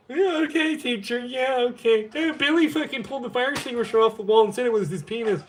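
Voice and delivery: high, mocking tattletale kid voice; snitch kid voice. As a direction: pitch it way up like a whiny kid ratting to the teacher.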